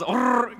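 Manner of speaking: long buzzing rolled Rrrr; heavy rolled r. Shown as a demonstration. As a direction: trill the R like rumble strips. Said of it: "Can y'all roll your R's like that?".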